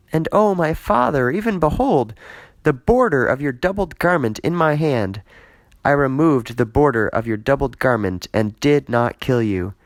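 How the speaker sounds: an earnest servant pleading before his king; David pleading. Acted. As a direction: plead your innocence to the king. Reader voices David pleading.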